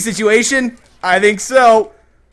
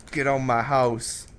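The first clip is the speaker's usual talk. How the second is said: normal talking voice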